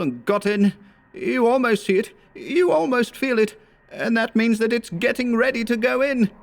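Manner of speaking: high, frantic voice of a man out of his mind; raving victim pouring out his nightmare; feverish gibbering. A narrator's reading. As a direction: babble it like a terrified madman. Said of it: "issued a torrent of gibberish".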